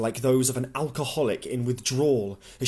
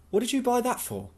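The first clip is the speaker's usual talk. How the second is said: put-on lightly raised young woman's voice, questioning; a raised, pointed question from Louise; Louise voiced higher; 12 semitones higher than usual. Louise asks Tom why he bought the wine, voiced higher.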